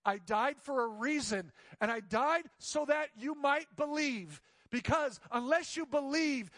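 high, slow, earnest plea; pleading. Retold or imitated. Jesus urging Thomas to believe, slow pleading. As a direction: beg them to believe, slowly and urgently. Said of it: "He's pleading".

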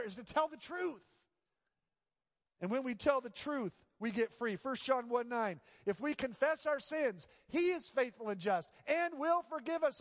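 preaching. Acted. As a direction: press the point with conviction. Preaching at full force.

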